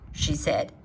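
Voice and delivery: ordinary delivery, nothing marked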